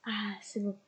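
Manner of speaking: soft whispered aside; whispered relief. Real, not acted. Streamer relieved to herself as the game loads, whispered. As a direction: breathe it out in a whisper.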